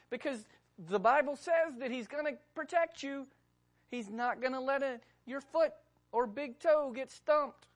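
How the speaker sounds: high, loud, mocking tempter; coaxing devil. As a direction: coax him like a sly tempter. Preacher voicing the devil coaxing.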